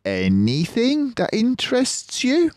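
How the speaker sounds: high, bright-pitched figure asking; character voice. Acted. Mister Fox's voice, pitched high, asks the cubs. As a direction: lift the voice up for the character.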